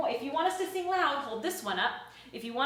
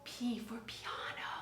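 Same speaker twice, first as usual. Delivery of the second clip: soft unvoiced "piano" whisper; 3 semitones lower, 14 dB quieter, slower than usual. Shown as a demonstration. Teacher whispering "piano" to show the children quiet. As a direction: breathe "piano" as a hush. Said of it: "And whisper".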